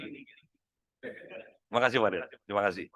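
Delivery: low, slow, soft thank-you; soft greeting. Shown as a demonstration. Trainer demos a gentle thank-you at a handshake, low and slow. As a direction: murmur the thanks slowly and warmly.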